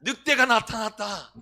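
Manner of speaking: loud call of the alarm; crying wolf. Retold or imitated. Preacher acting the shepherd boy again for the children, a loud call. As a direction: call out the warning to the village. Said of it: "전혀 모르는 얼굴".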